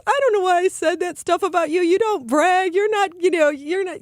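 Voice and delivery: high, teary daughter voice; daughter's apology. Retold or imitated. Mother voicing daughter's tearful apology, high. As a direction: play the remorseful daughter, pitched well up.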